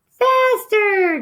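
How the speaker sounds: high, loud squeaky demand; a tiny mouse bossing the driver; demanding little cousin. A narrator's reading. Little cousin on the motorcycle, high loud demand. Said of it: "demanded the cousin".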